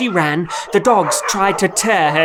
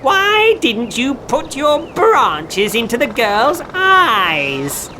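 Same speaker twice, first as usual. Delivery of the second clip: higher, furious witch question; angry witch; 8 semitones higher, slower than usual. A narrator's reading. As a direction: demand it like a raging witch. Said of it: "ran quickly to the birch tree".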